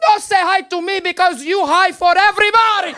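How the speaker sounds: put-on slow, high scolding in a heavy accent; hammed-up accented mom, building outrage; angry immigrant mother. Mother pounces on son at the door, high accented scolding. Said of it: "in her anger".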